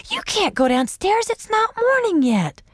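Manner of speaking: put-on high, bossy little girl; scolding child. A narrator's reading. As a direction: tell him off firmly. Sister scolding brother.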